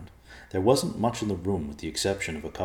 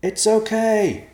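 loud, high, drawn-out shout; slow high-pitched holler; crying out; 10 dB louder, much slower than usual. Acted. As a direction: yell reassurance up to friends. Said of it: "Evercloud cried".

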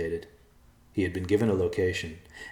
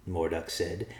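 ordinary delivery

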